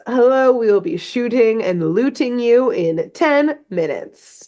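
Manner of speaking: put-on sing-song European-accented pirate, falling away at the end; Don Carnage. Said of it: "So, yeah, he says".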